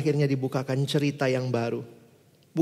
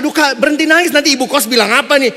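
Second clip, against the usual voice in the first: flustered inner protest; 7 semitones higher, 11 dB louder than usual. Retold or imitated. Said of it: "Saya kan bingung gitu".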